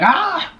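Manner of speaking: short, high-pitched mock scream; re-enacted scream. Retelling the table popping up, mock startled scream. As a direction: squeal as if the table just jumped. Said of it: "and I screamed".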